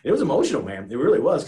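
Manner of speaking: fighting tears while telling it; real emotion breaking through; holding back tears. Real, not acted. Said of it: "not to cry".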